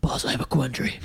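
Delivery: a hint of whispered Batman rasp.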